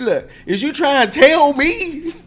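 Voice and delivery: very high, loud, slow, stretching tell; sassy neck-wag. Shown as a demonstration. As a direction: wag your neck and draw out the challenge. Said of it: "you get to wag your neck".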